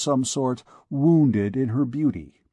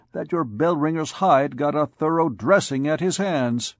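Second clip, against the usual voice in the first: higher, scornful, rising outrage; indignant captain; 3 semitones higher than usual. Acted. Captain scorning the bell-ringer before the ladies. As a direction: sneer at the lout's cheek.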